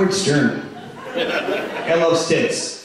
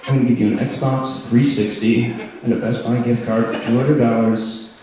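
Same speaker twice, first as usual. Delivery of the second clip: Howard Stern impression; 4 semitones lower than usual. Radio host bribing a woman guest, lightly voiced low. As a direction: make a sleazy low radio offer. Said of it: "It's just like".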